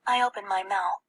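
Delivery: usual speaking voice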